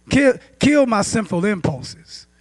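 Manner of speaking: ordinary talk, nothing marked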